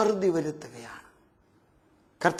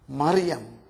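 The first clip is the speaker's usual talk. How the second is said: tender call; 6 semitones lower, much slower than usual. Acted. Jesus calls Mary by name.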